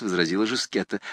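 usual speaking voice

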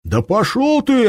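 high, loud, slow furious yell; frenzied. Acted. Shams tears free from the commander, frenzied yell. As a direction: spit it out in fury. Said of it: "остервенел Шамс".

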